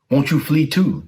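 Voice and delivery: pleading with their leader to run; worried monks. Acted. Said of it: "they asked him".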